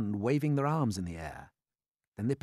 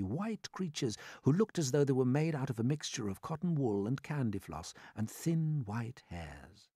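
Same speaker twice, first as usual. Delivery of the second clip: usual speaking voice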